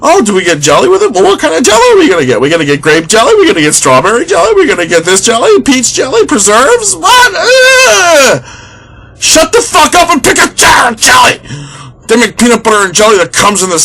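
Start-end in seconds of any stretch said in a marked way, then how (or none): 0.1-6.9 much higher, sing-song voice of a fussy couple debating groceries; lovey-dovey shopper voice, much higher and fussy; mock couple chatter. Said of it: "And they're going". 6.9-11.4 hammed-up outburst at an indecisive partner; exasperated mock shout. Partner snaps at dithering couple.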